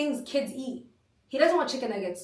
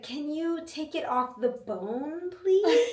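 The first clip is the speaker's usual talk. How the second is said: put-on slow, higher pleading kid voice; imitated picky child; 3 semitones higher, much slower than usual. Retelling child asking for boneless chicken, slow pleading. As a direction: stretch out a fussy little request. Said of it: "He was like".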